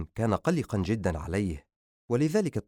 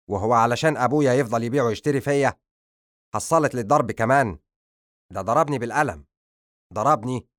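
louder, wounded protest; hurt anger; 2 semitones higher, 6 dB louder than usual. Acted. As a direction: insist he was slapped. Son complains he was slapped.

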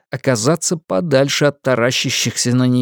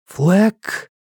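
ordinary delivery

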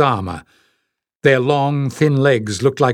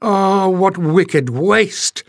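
slow, drawn-out lament, higher; 6 semitones higher, much slower than usual. Acted. Pen lamenting the wrecked boat, slow and mournful. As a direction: stretch it out in grief. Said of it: "she wailed".

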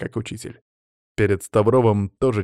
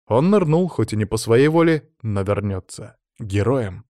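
usual speaking voice

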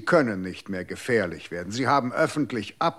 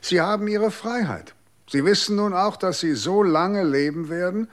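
triumphant verdict; 7 semitones higher than usual. Acted. Interrogator to the accused murderer, raised, emphatic verdict. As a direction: hand down his fate with cutting emphasis.

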